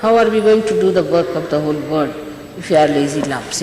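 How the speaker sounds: ordinary talk, nothing marked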